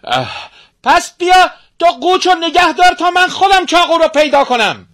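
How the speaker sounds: high, loud, strained villain voice; sorcerer father. Acted. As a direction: snap back impatiently at the girl. Sorcerer father answers daughter.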